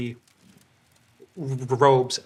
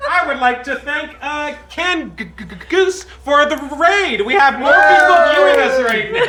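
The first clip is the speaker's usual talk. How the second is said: talking through giggles; can't stop laughing while talking; 10 semitones higher, 8 dB louder than usual. Real, not acted. GM laughing after the popcorn mishap.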